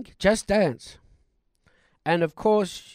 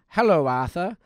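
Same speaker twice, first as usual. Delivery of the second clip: ordinary talk, nothing marked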